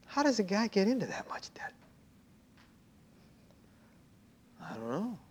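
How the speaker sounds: confiding whisper; whispered aside. Acted. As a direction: lean in and whisper the question. Rhetorical aside, hushed.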